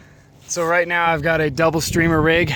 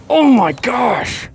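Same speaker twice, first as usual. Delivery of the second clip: excited shout; 13 semitones higher, 4 dB louder, much slower than usual. Real, not acted. Big fish hooked.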